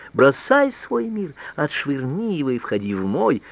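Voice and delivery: high-pitched mock command; передразнивает требование. A narrator's reading. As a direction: quote the lover's demand back at him, starting high and drawn out. Annette mimics Roger's demand. (передразнивая, высоким повелительным голосом)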